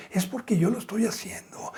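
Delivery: confiding whisper to the brothers. Real, not acted. Pastor whispers a secret to the congregation. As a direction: lean in and whisper it. Preacher lowers to confidential aside.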